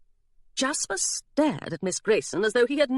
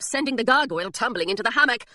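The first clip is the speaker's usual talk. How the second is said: usual speaking voice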